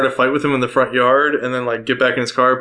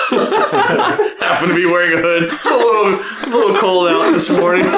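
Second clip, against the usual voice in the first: talking through giggles, pitched up; laughing; 5 semitones higher than usual. Real, not acted. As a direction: crack up while making the joke. Joking through laughter about a disguise.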